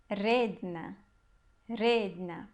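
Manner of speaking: redna with a strong, drawn-out first syllable RE; low, careful redna, leaning on the RE; stress on RE. Shown as a demonstration. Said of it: "a sílaba forte aqui é o re".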